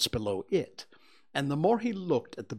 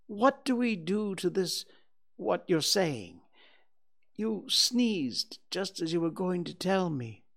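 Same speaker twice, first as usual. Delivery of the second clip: higher-pitched bewildered bear; Pooh, puzzled; 6 semitones higher than usual. Acted. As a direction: voice a confused little bear asking questions.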